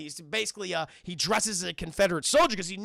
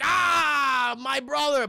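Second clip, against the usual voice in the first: high, loud, hammed-up welcome; acted booming hello; joyful greeting; 6 semitones higher, 6 dB louder, much slower than usual. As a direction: cry out delighted at a reunion. Tuco greets long-absent brother.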